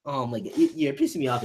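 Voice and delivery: usual speaking voice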